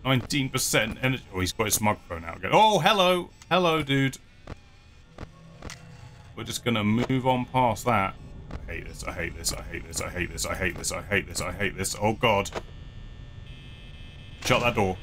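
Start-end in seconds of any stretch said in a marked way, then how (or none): 2.5-4.2 sarcastic greeting. Pretend. Mock greeting to animatronic. 8.7-12.0 anxious muttering. Real, not acted. Muttering in panic.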